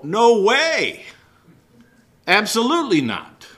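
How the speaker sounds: high, loud "No way! Absolutely not."; modern slang denial. Retold or imitated. Preacher paraphrasing Paul in slang, loud and high. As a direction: blurt it like a shocked teenager. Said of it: "We might say in modern lingo".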